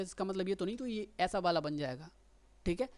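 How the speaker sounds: usual speaking voice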